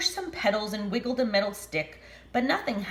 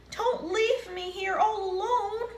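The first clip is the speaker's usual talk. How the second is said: pleading; 13 semitones higher than usual. Acted. Sheep left behind.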